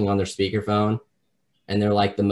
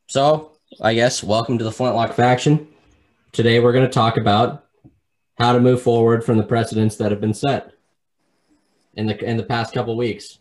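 loud announcer intro voice; podcast intro; 2 semitones higher, 7 dB louder than usual. Acted. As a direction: open the show like a host.

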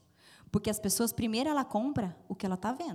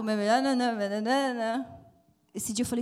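ordinary talk, nothing marked

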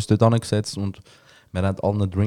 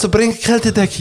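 mock whine; 11 semitones higher than usual. Mocking the fussing helpers.

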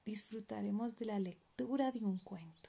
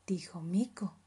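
usual speaking voice

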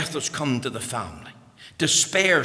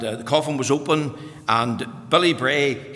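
normal talking voice

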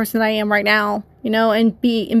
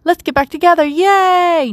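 high, bubbly, overly eager hello; mock cheery greeting; 6 semitones higher than usual. Mocking how she won't greet her ex, high and gushing. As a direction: gush at the ex in a sing-song tone, mocking it. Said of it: "I don't wanna just go, like".

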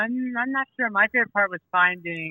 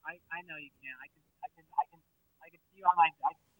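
faint, far from the mic; talking away from the phone; phone held away; 10 dB quieter, much faster than usual. Real, not acted. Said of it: "I can't hear you very well".

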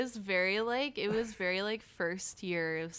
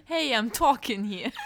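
mock mafia; 3 semitones higher, 5 dB louder than usual. Friend echoes Joy's mob-style line in fun. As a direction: play a New York tough guy. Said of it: "mafia voice".

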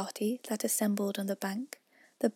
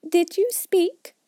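high, slow pointed question; stern mouse; 9 semitones higher, 6 dB louder, slower than usual. A narrator's reading. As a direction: demand it with frosty courtesy. Mouse challenging the lorry.

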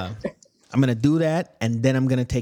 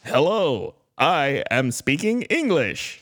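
slow, stiff mock-proper English; his "English"; slower than usual. Performing his new English for a friend, stiff mock-proper English. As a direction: enunciate like a language learner, slowly. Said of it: "you wanna hear my English?".

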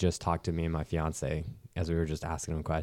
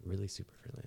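muttered repeat; 15 dB quieter, faster than usual. Real, not acted. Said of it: "That's redundant as fuck".

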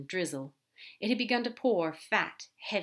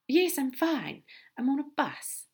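a touch higher and louder for the angel; lightly voiced angel; 7 semitones higher, 4 dB louder, slower than usual. Aziraphale reassuring Crowley on the phone, higher-voiced.

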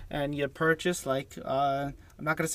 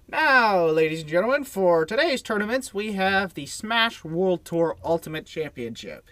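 announcer voice; 4 semitones higher, 5 dB louder than usual. Acted. Podcast host announcer segment intro.

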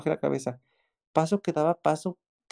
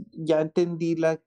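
ordinary talk, nothing marked

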